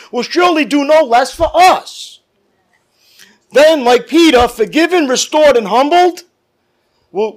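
rising, loud revival preaching, slowing on key words; preaching at full force. Acted. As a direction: build the congregation up with fire. Preacher building to climax.

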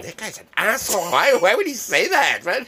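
high-pitched mock disbelief; appalled onlooker. Subway passengers reacting to the prank, in high appalled disbelief.

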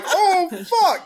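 a mock-shocked groan; laughing dismay. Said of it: "they're like".

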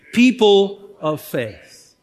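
slow, firm call on "People of faith"; congregational call-out. Shown as a demonstration. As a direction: draw out "People of faith" for everyone to join in. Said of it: "Come on, say that with me".

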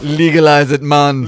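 mock Jamaican. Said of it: "I hate that old Jama".

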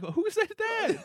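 high-pitched, loud startled question; startled retort. Retold or imitated. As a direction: shoot up high in loud disbelief. Shocked reply retold in story.

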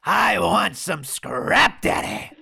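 very slow, gravelly put-on voice; drawn-out gravelly tough-guy line; gravelly impression. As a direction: drag it out slowly in a rasp. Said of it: "he has more of a gravelly voice".

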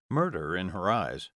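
ordinary delivery

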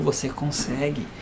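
low, soft, slow fatherly reassurance; calm encouragement. Retold or imitated. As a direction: quietly insist to a doubting child. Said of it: "Eu falo bem assim".

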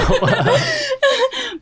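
high burst of laughter; laughing. Real, not acted. Laughing at own rushing.

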